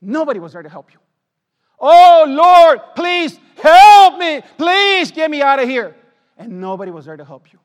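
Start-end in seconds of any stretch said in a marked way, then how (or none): 1.8-6.0 loud, high, slow plea to the Lord; lost soul pleading with the Lord to be rescued; anguished shout. Pretend. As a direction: beg at full voice, drawn out. Sinner crying out in torment.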